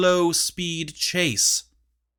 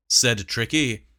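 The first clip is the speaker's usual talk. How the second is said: usual speaking voice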